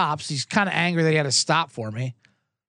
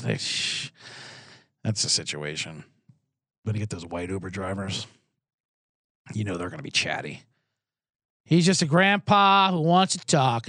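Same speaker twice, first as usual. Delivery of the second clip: usual speaking voice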